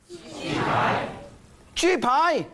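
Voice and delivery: ji pai called out high as a teaching drill; Cantonese drill. Shown as a demonstration. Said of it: "This is how they say it in Chinese".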